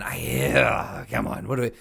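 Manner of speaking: ordinary talk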